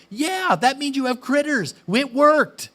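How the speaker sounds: high, loud cheerful insistence; delighted, almost cheering; excited. Retold or imitated. Her excited retort in quarrel.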